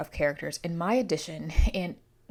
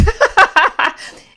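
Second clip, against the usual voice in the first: a loud, high cackle; loud high laugh; 17 dB louder than usual. Real, not acted. Laughs at own flub.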